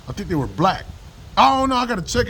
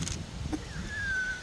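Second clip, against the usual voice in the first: high laugh; 15 dB quieter than usual. Real, not acted. Laughs at the mockery.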